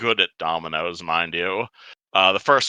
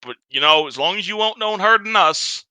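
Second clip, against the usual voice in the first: put-on high, broken Sharn accent; strong Sharn accent; 5 semitones higher than usual. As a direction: push a thick Sharn accent. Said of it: "in a very strong Sharn accent".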